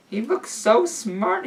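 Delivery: lightly voiced awed father; soft awed voice. A narrator's reading. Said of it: "he whispered and smiled".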